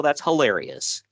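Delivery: usual speaking voice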